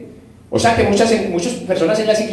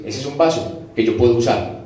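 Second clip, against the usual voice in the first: lower, calm voice of God; 6 semitones lower than usual. Acted. God approves a clean vessel, lower and calm. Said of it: "Entonces Dios dice".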